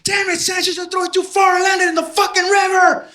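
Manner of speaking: high, loud yelled scolding; mock yell. A soldier yells at Sanchez for throwing too far, high and loud. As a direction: scold him at the top of your voice. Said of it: "somebody yelled at me".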